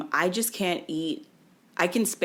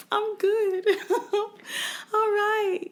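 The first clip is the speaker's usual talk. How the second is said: much higher, giggling through it; giddy laughing; much slower than usual. Retold or imitated. Groggy patient giggling to the nurse. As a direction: laugh it off, woozy and high. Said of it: "I'm like".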